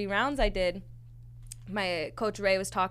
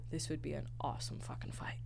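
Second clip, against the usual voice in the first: hushed unvoiced whisper; conspiratorial whisper about the fight; 6 semitones lower, 12 dB quieter than usual. Real, not acted. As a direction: whisper it like a secret.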